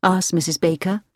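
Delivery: ordinary delivery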